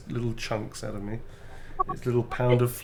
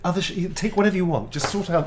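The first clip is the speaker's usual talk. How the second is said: mock plea; 7 semitones higher, 4 dB louder than usual. Pleading to Illuminati jokingly.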